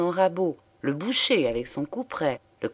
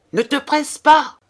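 loud, high shout from the crowd; high onlooker's yell; called-out advice; 11 semitones higher, 9 dB louder than usual. A narrator's reading. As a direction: call out urgently to the gunman. Onlooker calls advice to shooter.

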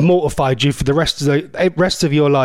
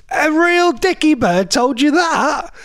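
put-on amazed child; 10 semitones higher, slower than usual. Child self amazed at nanny.

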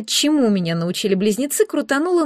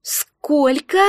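raised, rising question; astonished exclamation; 5 semitones higher than usual. A narrator's reading. Both girls hear the egg's age, astonished. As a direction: ask it in disbelief, pitch lifting. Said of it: "воскликнули мы с Оливией".

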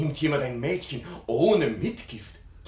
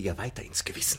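whisper; 8 semitones lower, 7 dB quieter, faster than usual. Acted. Aside to the father.

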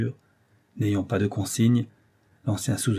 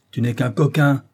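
raised, higher scolding voice; exclaimed reproach; 5 semitones higher, 7 dB louder than usual. Acted. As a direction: cry it out as a rebuke. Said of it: "s'écria-t-il".

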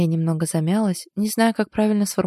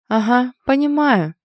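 slow, loud, higher-pitched sarcasm; displeased; 6 semitones higher, 5 dB louder, slower than usual. A narrator's reading. Friend interrupting the excuses, displeased. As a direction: cut in with drawn-out displeasure. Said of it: "недовольным голосом перебила Вика".

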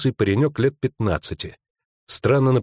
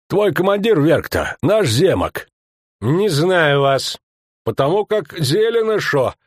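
higher, louder rustic thug; rough bandit voice; 10 semitones higher, 4 dB louder than usual. Acted. Skomlik talking his way past the guard, rustic bandit voice. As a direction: push your way in with swagger.

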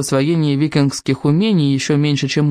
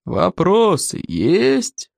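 slow growled question; growling; 4 semitones higher, much slower than usual. A narrator's reading. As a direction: growl it out slowly. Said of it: "рыкнул Плевака".

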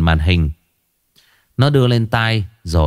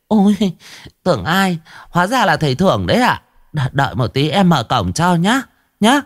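eager fawning woman; 8 semitones higher than usual. Acted. Vi greets the exorcist eagerly.

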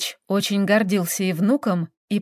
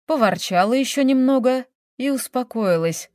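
usual speaking voice

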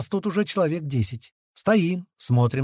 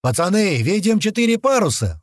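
raised, higher-pitched report; sudden radio alert; 7 semitones higher, 4 dB louder than usual. A narrator's reading. Lookout radioing the men about sails, urgent alert. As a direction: call out the sighting. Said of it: "внезапно заговорил в рацию Томас".

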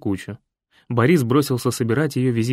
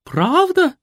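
high, loud astonished question; amazed; 5 dB louder than usual. A narrator's reading. Mother amazed that Vava saved Fred. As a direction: gasp it in wonder. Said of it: "изумилась маменька".

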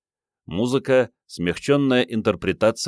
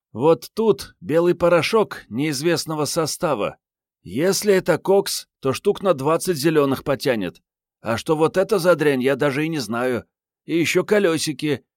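high-pitched female agent listing finds; Mariette, matter-of-fact appraisal; 9 semitones higher than usual. A narrator's reading. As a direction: pitch her well up, briskly curious about the evidence. (высокий женский голос, деловито перечисляет находки)

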